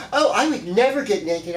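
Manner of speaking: a lightly mocking, prissy voice; prudish Virgo. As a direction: play the picky prude clutching her pearls. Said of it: "they're like".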